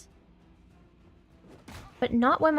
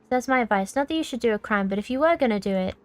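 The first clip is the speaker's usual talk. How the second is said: normal talking voice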